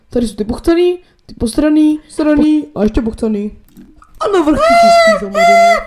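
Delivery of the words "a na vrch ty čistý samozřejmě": squealing laugh, far higher. Real, not acted. Joking to his friend about the dirty pants, squealing with laughter. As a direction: crack up mid-sentence.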